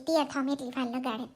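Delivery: ordinary talk, nothing marked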